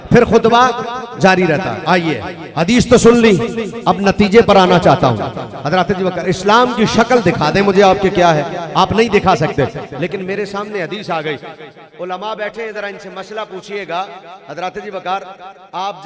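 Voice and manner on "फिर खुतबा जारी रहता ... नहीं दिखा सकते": loud, emphatic preaching; पुरज़ोर ख़िताबत. Real, not acted. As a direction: hammer the conclusion out to the crowd. Preaching at full force. (बुलंद आवाज़ में ज़ोरदार बयान)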